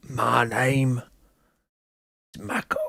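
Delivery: very slow, drawn-out self-introduction; attempted caster impression. Shown as a demonstration. Caster trying out a colleague's voice, slow and drawn out. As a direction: stretch out each word as a tentative impression. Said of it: "how does Seth sound".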